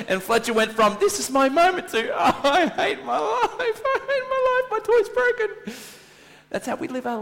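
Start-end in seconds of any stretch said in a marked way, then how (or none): 1.0-1.9 high, loud, exultant cry; mock triumph. Acting child's moment of triumph. 2.1-5.7 very high, loud, drawn-out wailing; hammed-up child wailing over a broken toy; mock tantrum wail.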